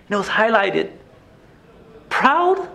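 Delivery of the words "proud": high, sharp disbelieving rise; can't believe the word he just read; incredulous question. Real, not acted. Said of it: "didn't even know how to respond".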